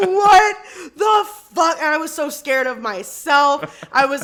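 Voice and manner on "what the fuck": high, loud mock panic through laughter; startled shout. Retelling fright at her own voice, laughing shout. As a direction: shout each word in comic alarm.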